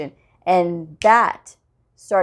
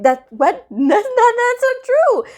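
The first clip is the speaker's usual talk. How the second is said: high, loud, stunned protest; shocked disbelief; 5 dB louder than usual. Retold or imitated. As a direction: sound floored by the accusation. Disbelief at false complaint.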